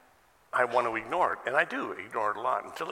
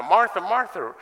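high, loud chiding call; imitated loud appeal; emphatic repeated name; 9 semitones higher, 11 dB louder than usual. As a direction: call her name twice, pitched well up. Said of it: "Jesus did the same thing with Martha".

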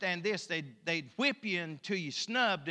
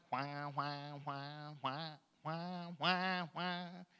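soft repeated mock whine like an engine warming up; tuning up whining; 4 semitones lower, 8 dB quieter, much slower than usual. Shown as a demonstration. Preacher shows himself starting to whine, tuning up whining. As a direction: drone out the whines, quiet and stretched. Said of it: "I tune my whiner up".

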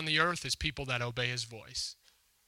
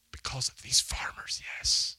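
hushed whisper. Real, not acted. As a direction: whisper it close and intimate. Preacher whispers the key word.

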